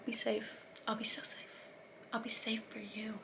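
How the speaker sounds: soft breathy whisper of a sweet goodbye; hushed teasing imitation of lovers; mock whisper. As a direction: whisper it like a lovestruck couple. Mocking the couple's sweet goodbye.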